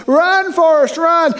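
a high, urgent call of the name; mock yell. Friend yelling at the boy to flee the bullies, high urgent call. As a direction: shout him into running like the friend. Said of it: "and Jenny says".

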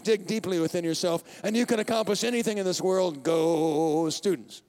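hammed-up commencement speaker; mock graduation speech. Preacher mocking a cliché graduation speech, hammed-up. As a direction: pump up the students like a cliché speaker. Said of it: "I can give you the speech".

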